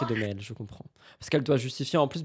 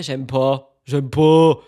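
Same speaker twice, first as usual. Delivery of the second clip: louder, stubborn j'aime pas; emphatic dislike; 9 dB louder, much slower than usual. Real, not acted. Streamer rejecting what he does on screen, loud refusal. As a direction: push the refusal out harder each time.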